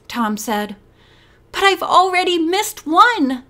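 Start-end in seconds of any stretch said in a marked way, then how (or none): 1.5-3.4 higher, pushed protest. Acted. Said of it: "Bess protested".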